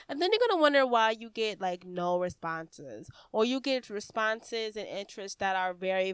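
ordinary talk, nothing marked

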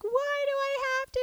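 put-on high, whiny little boy on the verge of tears; whiny crying child. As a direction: whine it like a hurt five-year-old. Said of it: "he'd come crying".

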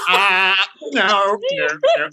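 high, wailed mock panic; retold alarm. Retelling their reaction to air in the ear, comic alarm.

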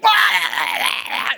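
loud mock dog yelp. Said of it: "and the dog's going".

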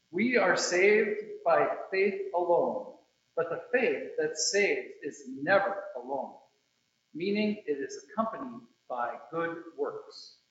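ordinary delivery

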